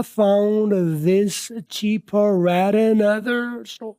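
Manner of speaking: stretched out far past normal speed; very slow demo. Shown as a demonstration. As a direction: spell out each sound slowly for students. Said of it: "मैं slow भी बोल रहा हूँ". (बहुत धीरे धीरे खींच कर पढ़ा गया वाक्य)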